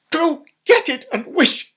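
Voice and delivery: very loud, high urgent command; frantic cry. Acted. Wife cries to her husband, very loud and high. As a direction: scream it at him. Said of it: "cried his wife".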